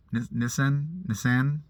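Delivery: Nissan tried tentatively as "NISS-an"; short i in Nissan, questioning rise; unsure British attempt. Shown as a demonstration. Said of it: "I'm not British, so I can't say it".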